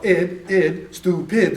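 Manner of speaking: ID sounded alone, then STUPID in two parts; syllable by syllable. Shown as a demonstration. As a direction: chop out ID, then join STUPID as stu-pid. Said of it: "that's a two vowel word".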